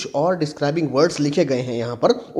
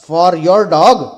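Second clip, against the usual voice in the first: louder questioning read with a touch of surprise; mild surprise; 8 dB louder, much slower than usual. A narrator's reading. Mrs Das questions a customer about her dog, mildly surprised. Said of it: "in surprise".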